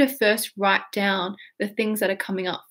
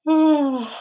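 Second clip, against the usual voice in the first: deep breath in; 4 semitones higher than usual. Shown as a demonstration. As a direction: breathe in hard and let it go.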